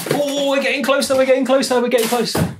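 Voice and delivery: high, eager repeated chant; mounting excitement. Real, not acted. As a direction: squeal with anticipation as you get near. Excitedly nearing the box contents.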